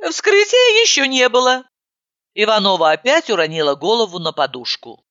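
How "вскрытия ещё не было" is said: voiced high and light; higher voice. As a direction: her own character, pitched up. Narrator voices herself, higher.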